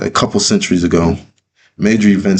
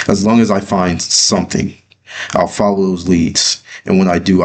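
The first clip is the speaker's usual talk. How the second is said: usual speaking voice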